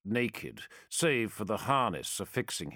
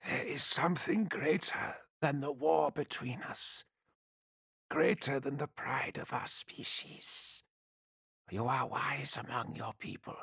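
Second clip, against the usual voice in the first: quiet, persuasive alien voice; soft alien diplomat; 4 semitones higher, 3 dB quieter than usual. Acted. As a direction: coax him gently, half whispered. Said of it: "in the dry whisper of the Tau voice".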